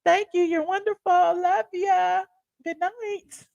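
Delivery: high-pitched, playful good night; high little goodbye voice; cutesy sign-off. Pretend. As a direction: bid the viewers farewell in a tiny high voice. Cutesy farewell to the moderators.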